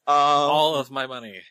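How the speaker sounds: loud, high, drawn-out declaration; mock eager shout. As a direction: blurt it loudly, stretching every word. Joking demand to take money.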